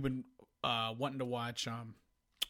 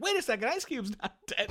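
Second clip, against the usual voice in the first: mocking the wife; 9 semitones higher than usual. Wife's realization, mimicked.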